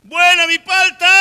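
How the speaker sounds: high, very loud avocado seller's cry; street vendor's shout. Acted. Preacher re-enacting himself selling avocados, loud hawker's shout. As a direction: holler your wares across the street. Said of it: "gritando mis, mis paltas y mis quesos".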